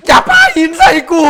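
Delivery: very high, loud cracking scream; screamed plea. Real, not acted. Gamer begging titan not to throw rocks, screamed.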